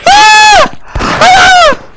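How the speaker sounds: piercing high-pitched screams; panicked screams. Real, not acted. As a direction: scream as death closes in. Nearly dying in the fight.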